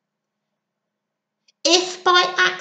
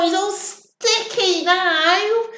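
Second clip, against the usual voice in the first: ordinary delivery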